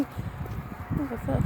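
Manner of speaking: breathy whispered aside, slow and hushed. Retold or imitated. Mom recalls the eerie feeling at home, whispering slowly. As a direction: hush it like you sense danger. Sensing something ain't right.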